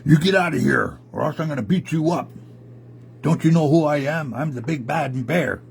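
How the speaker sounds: blustering bear, lightly hammed-up; angry bear. A narrator's reading. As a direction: play a big bad bear warning off a rival. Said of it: "that angry bear looked at him".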